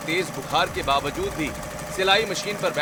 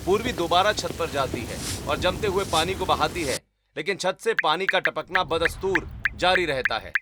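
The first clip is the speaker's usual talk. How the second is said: story narration; 3 dB louder than usual. A narrator's reading. Narrator describing the sister's night on the roof, storytelling. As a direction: narrate the scene calmly.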